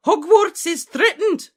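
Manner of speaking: high, loud, drawn-out proclamation; urgent rallying shout. A narrator's reading. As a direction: sound the alarm at full voice. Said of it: "shouted Professor McGonagall".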